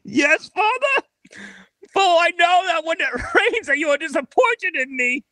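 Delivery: high, grand melodramatic lament; tragic heroine sorrow. Acted. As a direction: wail it like a tragic hero. Actor continues Hamlet monologue.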